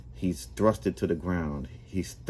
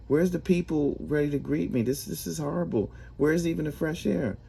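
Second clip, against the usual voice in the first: much higher, outraged whine; whiny complaint; 10 semitones higher than usual. Acted. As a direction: complain like a cheated customer at the counter. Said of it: "says to Lucifer".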